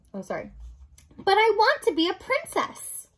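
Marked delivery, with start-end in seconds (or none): 1.2-3.1 high, loud, wanting wail; childlike protest. Acted. Mig begs the rat to be princess, high protesting voice. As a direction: plead loudly, pitched right up.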